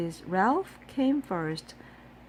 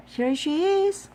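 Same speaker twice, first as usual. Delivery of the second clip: high, louder cheerful call; ladies' welcome; 10 semitones higher, 6 dB louder than usual. A narrator's reading. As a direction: greet her happily. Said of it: "said the ladies".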